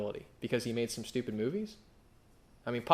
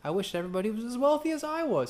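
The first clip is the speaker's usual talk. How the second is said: quoting Jim Carrey; 11 semitones higher, 3 dB louder than usual. Retold or imitated. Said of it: "Jim Carrey ... who says".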